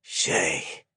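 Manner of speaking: soft, gentle call of her name; gently. A narrator's reading. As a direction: say her name softly, tenderly. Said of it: "Hilo said gently".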